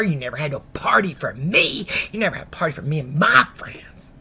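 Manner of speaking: aggrieved, whining daughter's protest; mad. Retold or imitated. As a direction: pour out your resentment at mama. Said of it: "daughter was so mad".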